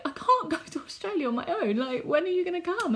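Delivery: high-pitched, imitated tearful protest; acted crying complaint; mock tearful friend. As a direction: plead like a friend in tears. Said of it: "we were both crying".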